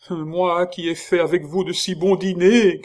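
high, loud wailing cry, half suffocating; choking wail. A narrator's reading. The monk wails to Chicot, choking with fear. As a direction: wail out the shared dinners. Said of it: "cria-t-il en suffoquant".

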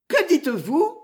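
high, shocked cry of new terror; terrified cry. A narrator's reading. Marianne hearing bad news, a high terrified cry. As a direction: her voice jumps up in alarm. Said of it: "s'écria Marianne en proie à une nouvelle terreur".

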